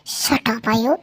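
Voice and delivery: ordinary delivery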